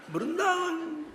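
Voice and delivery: high-pitched, talking through laughter; laughing. Real, not acted. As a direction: crack up while telling the story. Laughing at his own anecdote.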